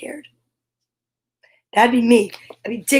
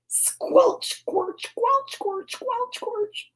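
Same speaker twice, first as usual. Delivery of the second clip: much higher and fading softer; high squelching; 10 semitones higher, 6 dB quieter, much slower than usual. A narrator's reading. Reader voicing squelching mud, high and fading. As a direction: squeak the squelches up high and let them fade.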